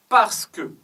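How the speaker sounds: parce que punched high and loud; teacher highlighting the cause, parce que stressed. Shown as a demonstration. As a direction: lean hard on parce que to show the cause. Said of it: "on insiste sur la cause".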